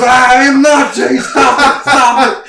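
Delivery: put-on loud, high, laughing kid's protest; a little brother yelling stop it between laughs; whiny protest. Little brother protesting to his teasing big brother, loud and laughing. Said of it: "He goes".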